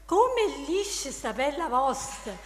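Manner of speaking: reciting verse in Neapolitan dialect; Neapolitan dialect poem. Acted. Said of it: "Dillo, dai, alza così".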